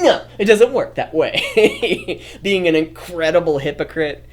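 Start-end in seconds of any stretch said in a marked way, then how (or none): 0.0-2.2 nose-in-the-air snooty, high and slow; laughing mock snooty retort. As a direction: sniff it like a hypocrite. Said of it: "puts his nose up into the air".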